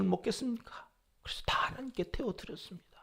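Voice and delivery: whispered, humble confession. Retold or imitated. Aaron answering Moses, humble whisper. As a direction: hush it like an ashamed father.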